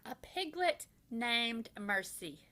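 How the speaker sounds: plain reading voice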